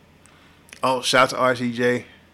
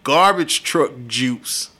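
trash talk; 5 dB louder, much slower than usual. Real, not acted. Drawn-out insult, laughing.

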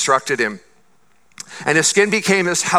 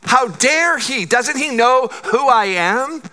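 higher, slow indignant outburst of a proud general; offended Naaman; 6 semitones higher than usual. Retold or imitated. Preacher voicing Naaman offended at the servant, indignant and higher. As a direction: play the insulted commander, drawn out and high.

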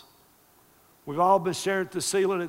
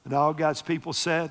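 usual speaking voice

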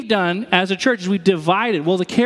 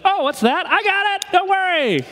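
very high, cartoonish put-on voice; mock overeager helper; 12 semitones higher than usual. Preacher mimicking someone rushing to fix damage, high silly eagerness. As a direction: squeal it like a clueless, too-confident fixer. Mocking an overconfident untrained helper.

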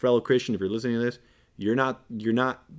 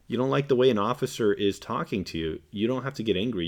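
ordinary talk

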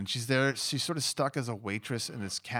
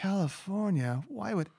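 higher, drawn-out disbelief, imitating the girl; incredulous teen; 7 semitones higher than usual. Checkout girl to a visiting Californian, imitated incredulity. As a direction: voice a baffled girl, dragging out the question. Retelling a disappointed teenage checkout girl's reaction.

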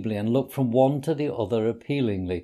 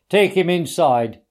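stern; 5 semitones higher, 6 dB louder than usual. A narrator's reading. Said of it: "he said sternly".

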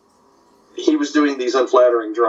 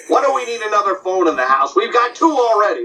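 Jack Benny voice; 6 semitones higher than usual. Retold or imitated. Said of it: "doing Benny and Rochester".

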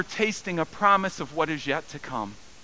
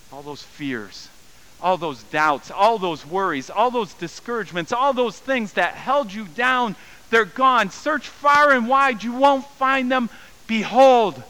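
builds from plain to much louder and higher; preaching crescendo; 9 semitones higher, 7 dB louder than usual. Acted. Pastor assuring the congregation, building to fervent preaching. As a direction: swell into full-force preaching.

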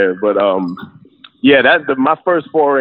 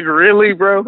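high, disbelieving "really, bro?"; incredulous; 10 semitones higher, slower than usual. Retold or imitated. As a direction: ask it like you can't believe it. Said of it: "I was like".

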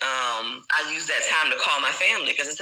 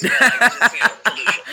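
laughing; 9 semitones higher, 4 dB louder than usual. Real, not acted. Laughs off tears, choking up.

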